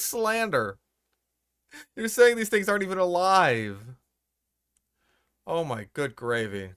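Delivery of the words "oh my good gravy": ordinary delivery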